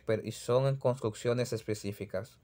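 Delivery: ordinary talk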